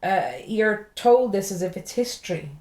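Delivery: normal talking voice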